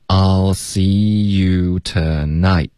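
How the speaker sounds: very slow, drawn-out model; slow linking demo. Shown as a demonstration. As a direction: stretch each word so learners hear it. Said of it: "我们请老师来先示范一下". (慢速拉长示范)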